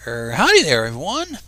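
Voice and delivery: loud, twangy Southern howdy over a speaker; southern drawl. Acted. Announcer on the loudspeaker greeting the lunchroom, Southern drawl. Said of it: "in a noticeable southern drawl".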